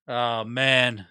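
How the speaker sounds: ordinary delivery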